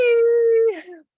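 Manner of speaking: laugh. Real, not acted. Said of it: "We don't wanna finish off with the sad".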